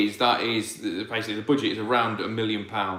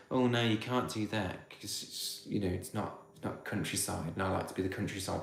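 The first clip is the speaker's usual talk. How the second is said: softer, prissy put-on complaint; mock townie whine; 4 semitones lower, 11 dB quieter than usual. Mimicking an imagined complainer about town life, soft mock whine. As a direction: parody a fussy complainer, quietly. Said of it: "But I would never go".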